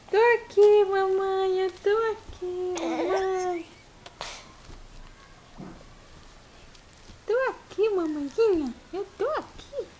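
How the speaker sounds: put-on high, whiny little-girl voice; baby voice. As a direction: answer as the baby, high and pleading. Mother voicing baby's reply.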